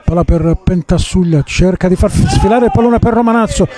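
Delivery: usual speaking voice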